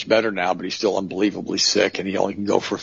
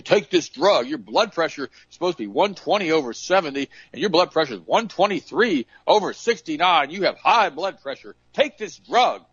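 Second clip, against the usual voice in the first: a lightly mocking, higher doctor voice; pompous doctor, sent up a little; 8 semitones higher than usual. Preacher mocks a doctor ordering pills, higher mock voice.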